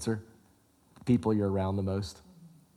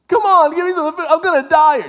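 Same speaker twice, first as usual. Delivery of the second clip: much higher and louder, fast frantic begging; desperate Esau; 11 dB louder than usual. Acted. Esau begging his brother for the soup, in a high, loud, fast frantic plea. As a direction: beg for the food as if starving.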